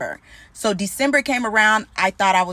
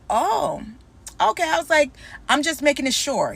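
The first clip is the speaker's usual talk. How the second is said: mock surprised okay; 6 semitones higher than usual. Mock acceptance of ex's rejection.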